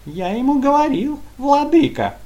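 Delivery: high, loud yappy lackey voice; yapping toady. Acted. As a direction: yap it like a fawning lapdog. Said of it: "тявкал мерзкий подхалим".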